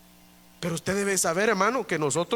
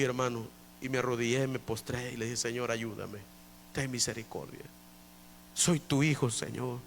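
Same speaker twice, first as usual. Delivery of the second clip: much lower and softer, kneeling prayer; humble hushed prayer; 7 semitones lower, 9 dB quieter than usual. Retold or imitated. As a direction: pray low and humbly on your knees. (oración humilde, muy baja y suave)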